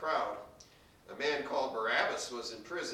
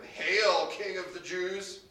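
drawn-out, higher, loud opening hail; mocking call; 6 semitones higher, 4 dB louder, slower than usual. A narrator's reading. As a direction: call out a mock salute to a king. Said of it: "mocked him and began to call out to him".

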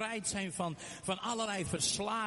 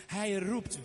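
ordinary talk

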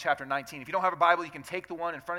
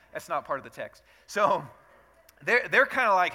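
ordinary talk

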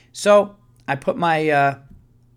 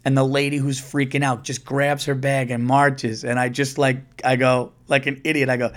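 normal talking voice

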